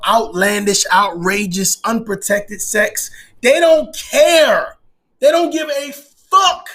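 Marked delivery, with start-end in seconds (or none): 3.4-6.7 high, loud, slow emphasis; emphatic rant. Real, not acted. Podcast host ranting about partygoers, loud and slow. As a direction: lean hard on every word. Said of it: "emphatic rant".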